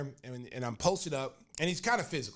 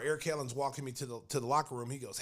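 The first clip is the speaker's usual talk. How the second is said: normal talking voice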